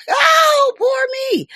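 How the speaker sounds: hammed-up self-pity cry, high and loud; mock whine. Mocking whiny critics.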